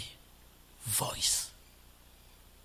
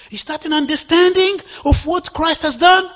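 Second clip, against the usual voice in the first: higher, louder challenge; rising rebuke; 9 semitones higher, 9 dB louder than usual. Real, not acted. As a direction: call it out to the congregation. Preacher rebukes loudly.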